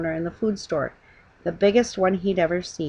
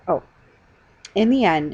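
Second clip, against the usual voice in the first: plain reading voice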